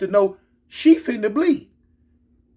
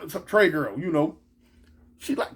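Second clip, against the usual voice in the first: ordinary talk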